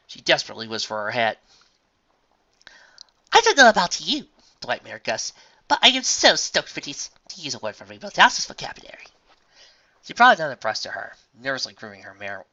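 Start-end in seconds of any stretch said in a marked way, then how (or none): 3.3-4.3 high, loud, eager lady; excited refined unicorn. Acted. 5.7-9.1 high, loud, eager lady; excited refined unicorn. Acted.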